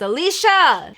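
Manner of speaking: grandma calling a name through the house, loud and high; retold yell. Grandma calling her granddaughter from the kitchen, loud high yell. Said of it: "her grandma yells and says".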